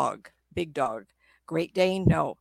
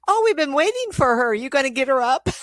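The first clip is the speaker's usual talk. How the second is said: put-on high, louder, sweetly eager old lady; 7 semitones higher, 5 dB louder than usual. Aunt's spirit greeting the medium, sweetly high and eager. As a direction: chirp it out like a delighted aunt.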